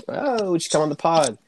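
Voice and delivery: normal talking voice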